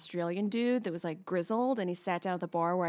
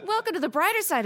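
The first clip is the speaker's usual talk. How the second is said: bright intro; 9 semitones higher, 4 dB louder, faster than usual. Acted. Jumps out of bit to intro.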